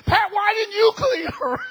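high, loud, slow imitation of the upset man; mock indignant whine. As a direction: ham up his outraged question. Quoting upset man, third time.

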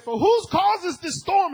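high, loud, slow cry of alarm; sailors calling out. Acted. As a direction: cry it over the storm. Sailors crying out in storm.

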